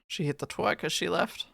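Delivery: ordinary delivery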